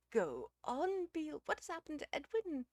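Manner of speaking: high, loud, urgent lady's voice; anxious pleading. A narrator's reading. Missus Uckridge presses Beale about Edwin, anxious. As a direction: beg for the news, pitched right up.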